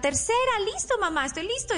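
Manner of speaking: voz aguda de niño dispuesto; niño que coopera. Acted. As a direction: imitando a un niño obediente, con tono agudo. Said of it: "sea que el niño coopere y diga". (niño obediente y dispuesto)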